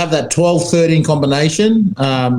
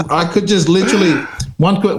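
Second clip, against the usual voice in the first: ordinary delivery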